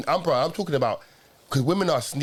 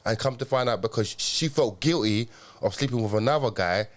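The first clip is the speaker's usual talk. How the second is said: ordinary talk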